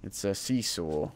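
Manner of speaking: ordinary talk, nothing marked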